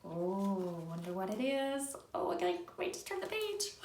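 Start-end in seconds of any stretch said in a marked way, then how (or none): none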